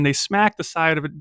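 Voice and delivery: usual speaking voice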